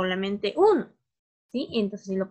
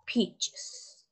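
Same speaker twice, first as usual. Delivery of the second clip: whispered word; 6 dB quieter than usual. Shown as a demonstration. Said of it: "unvoiced model word".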